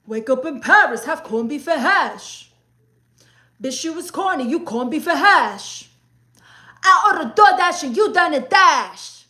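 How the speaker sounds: loud, high Bronx rap delivery with sass; brash Bronx rapper voice, sassy and loud. Shown as a demonstration. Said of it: "You do impressions? L O L, [name]".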